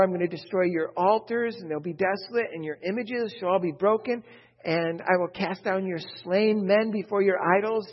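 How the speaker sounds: scripture reading. Acted. Preacher reading Ezekiel to the congregation, loud proclaiming. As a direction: declaim the prophecy to the congregation.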